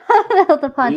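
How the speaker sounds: talking through real laughter; laughing. Real, not acted. Correcting their own slip to friends, laughing. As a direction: let the words break up into giggles.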